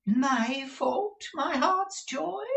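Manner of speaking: very high, slow, mock-hurt; high slow theatrical dismay; wounded dandy. A narrator's reading. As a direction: play the injured fop.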